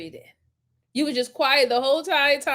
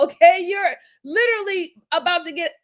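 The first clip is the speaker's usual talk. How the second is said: normal talking voice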